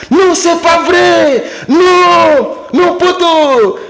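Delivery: high stretched shout of disbelief. Acted. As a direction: shout it like you can't believe him. Friend refusing to believe, skit.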